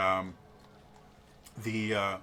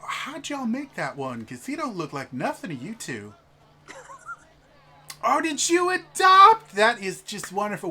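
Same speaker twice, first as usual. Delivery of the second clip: high, loud cooing lady; gushing noblewoman; 7 dB louder than usual. Acted. A noble wife fawning over the players' child, high and loud. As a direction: gush and fawn over the couple's kid.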